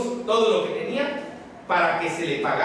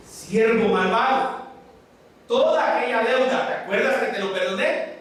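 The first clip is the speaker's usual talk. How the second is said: stern master; 5 semitones higher than usual. Acted. Master rebukes the wicked servant.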